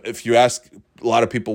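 ordinary delivery

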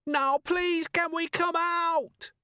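very high, drawn-out begging wail. A narrator's reading. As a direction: beg at the top of the range, stretching it out. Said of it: "the voice was a lot higher".

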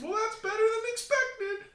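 squeaky high cartoon voice; silly high voice. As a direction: play a squeaky little team mascot. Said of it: "that stupid voice".

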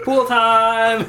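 high, loud, drawn-out cheer; excited cheer. Acted. Oblivious daughter comes downstairs, cheering loudly. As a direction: sing out happily, oblivious to danger.

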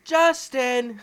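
loud teasing call of a name. Real, not acted. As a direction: shout the name out playfully. Calling out his real name.

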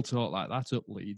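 a quick put-on Leeds accent, half joking; mock Yorkshire accent. Host mocking how Leeds folk talk, lightly in a Yorkshire accent. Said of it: "if you don't do that accent".

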